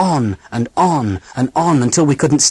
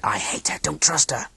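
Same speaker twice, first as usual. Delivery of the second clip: whispered; 3 semitones higher, 8 dB quieter than usual. A narrator's reading. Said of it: "Beth whispers".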